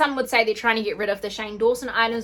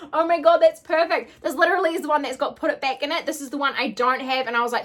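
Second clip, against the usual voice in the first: normal talking voice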